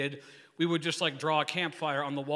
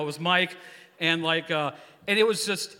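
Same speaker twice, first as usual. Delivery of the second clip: normal talking voice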